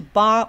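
bar 念得高而响; 纠正读法：把 bar 往上提; 重音往上高. Shown as a demonstration. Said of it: "弯高的". (高声强调 bar)

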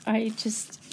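breath catching, voice gone; words lost to emotion; choked whisper. Real, not acted. Quilter overwhelmed by found quilt, whispering choked up. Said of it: "I'm gonna cry now".

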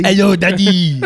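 loud, high, hammed-up French accent. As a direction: belt out a mock French greeting, laughing. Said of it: "they have a French accent".

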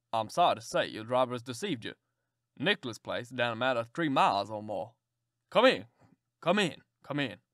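put-on low, hearty old gentleman; a kindly old man ushering a guest in. Old gentleman inviting the stranger in, low and hearty.

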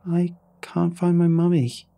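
put-on high, slow little girl's voice; hopelessly tiny voice. A narrator's reading. Lost girl tells the rescuer she can't find mummy, tiny voice. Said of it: "in that same hopelessly tiny voice".

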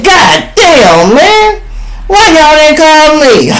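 high, loud, outraged whine; TMZ complaining. Acted. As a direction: squeal like a jilted buyer. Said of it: "I know T M Z saying".

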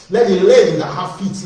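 "Let the lame" called out high and loud; loud scripture call; loud proclamation. Real, not acted. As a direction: proclaim it over the crowd.

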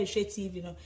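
ordinary talk, nothing marked

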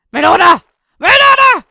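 very loud, rising yells; calling out. Pretend. Player calling after the melon, yelling.

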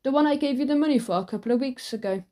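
plain reading voice

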